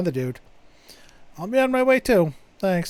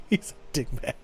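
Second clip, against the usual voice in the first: laughing; 5 semitones higher than usual. Real, not acted. Laughing at the boyfriend.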